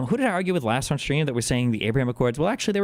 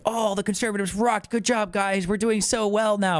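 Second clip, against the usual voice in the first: higher smug mock boast; mocking Trump; 7 semitones higher than usual. Mocking Trump claiming credit for German election, smug. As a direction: parody a boastful post.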